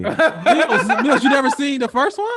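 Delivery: loud, high incredulous question through laughter; real laughing astonishment; laughing disbelief. Real, not acted. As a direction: ask it amazed, cracking up. Laughing disbelief at co-host's ignorance.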